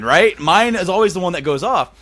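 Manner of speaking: ordinary delivery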